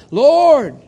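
loud high cry of anguish. Acted. Jesus crying out to God from the cross, loud anguished cry.

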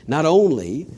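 usual speaking voice

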